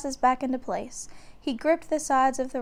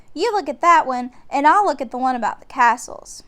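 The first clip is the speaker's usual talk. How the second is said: put-on bossy girl; 3 semitones higher, 7 dB louder than usual. A narrator's reading. Annie voiced.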